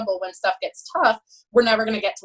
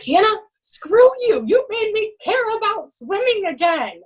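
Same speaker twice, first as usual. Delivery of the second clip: emotional swimmer; 11 semitones higher, 4 dB louder than usual. Retold or imitated. Crying swimmer quoted.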